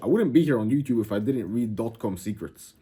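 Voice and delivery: usual speaking voice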